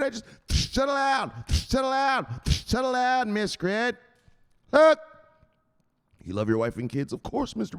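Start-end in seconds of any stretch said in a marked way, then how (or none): 0.0-5.0 high yelled settle down; mock shout. Enforcer yelling at the panicking investor, high and loud. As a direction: bark him back into line, hammed up.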